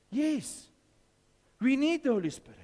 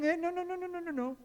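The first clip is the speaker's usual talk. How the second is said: a high, pleading no-no-no; inner voice of conscience; 8 semitones higher, 4 dB quieter, faster than usual. Retold or imitated. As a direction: plead like the Holy Spirit warning you. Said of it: "there's a voice saying".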